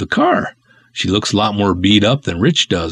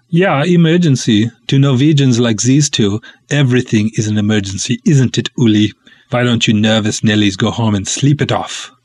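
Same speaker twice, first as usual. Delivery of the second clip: put-on Scandinavian accent. Lars mocks nervous colleagues in Scandinavian accent. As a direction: scoff at the panic in a comic Scandinavian accent. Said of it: "way too fun doing those ridiculous accents".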